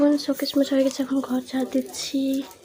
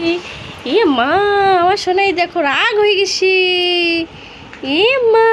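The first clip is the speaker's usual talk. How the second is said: calling out to daughter; 6 semitones higher, 12 dB louder than usual. Real, not acted.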